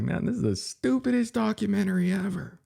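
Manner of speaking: mock-shocked high-voiced reaction; retold disbelief. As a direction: squeal it in disbelief. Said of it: "I was like".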